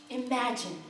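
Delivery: a firm, pointed "Imagine"; said pointedly. A narrator's reading. Storyteller pointedly urging the orphans, firm and deliberate. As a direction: lean on it to make the listeners think. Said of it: "he said pointedly".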